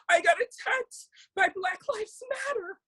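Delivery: high, whiny mock victim voice; mocking the woman. Mocking the attacker talking to news media, high and whiny. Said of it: "and she goes".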